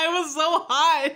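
high, loud laughing fit; helpless laughter. Real, not acted. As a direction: crack up while getting the words out. Helpless laughter at ruined product.

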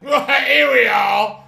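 shout. Real, not acted. Gamer arriving after the endless drive, slow loud shout. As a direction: bellow the arrival, drawn out.

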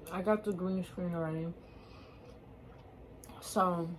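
talking with mouth full; eating between phrases; chewing food. Real, not acted. Seen 3: Cheeks bulge and jaw works between words; chopsticks and takeout box present.

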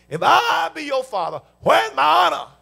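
God's voice, shouted high and loud; a loud shouted demand; booming voice of God. Acted. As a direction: thunder it out as God demanding honor.

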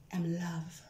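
ordinary talk

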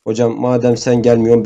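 ordinary talk, nothing marked

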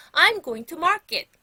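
loud, high, slow model sentence; loud model phrase. Shown as a demonstration. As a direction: call it out for pupils to echo. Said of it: "큰 소리로 얘기할 테니까".

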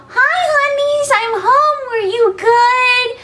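put-on very high, slow baby talk. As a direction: greet the pets in baby talk. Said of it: "full on baby talk".

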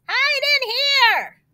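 very high, drawn-out call; crying out. A narrator's reading. Third pig calling his brothers inside, high slow cry. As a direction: call them in urgently. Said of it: "cried the third little pig".

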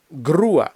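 груа with loud stressed у, separate а; split diphthong. Shown as a demonstration. Teacher models word for crane, vowels split. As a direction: punch the у in груа, two syllables. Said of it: "дифтонг розбивається на два окремих".

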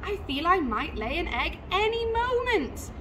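high-pitched, fluttery announcement; excited mother bird. A narrator's reading. Excited mother bird settling in nest.

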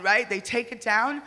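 ordinary delivery

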